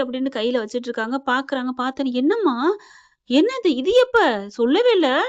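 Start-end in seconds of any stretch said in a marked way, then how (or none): 2.1-5.2 higher-pitched, acted-out surprised questions; mock startled daughter demanding answers; surprised questioning. Daughter finds the card in mother's hand, surprised questioning.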